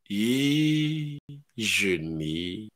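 low, very slow mocking drawl; mock reply. Believer mocks the call to fast, low slow drawl. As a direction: drag the word out lazily.

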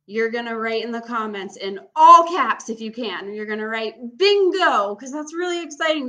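loud and eager, building hype; excited. Real, not acted. Excited instructions to campers.

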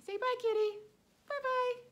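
very high squeaky cat voice; kitty voice. Pretend. Teacher voicing the toy kitty saying goodbye, very high. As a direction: say bye as the little kitty.

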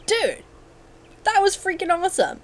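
high-pitched thrilled exclamation; excited. Real, not acted. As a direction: gush in a high voice. Celebrates escape in game.

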